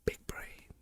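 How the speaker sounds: whispered, self-satisfied aside; smug whisper. Real, not acted. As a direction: whisper it like a clever secret. Smug aside after argument.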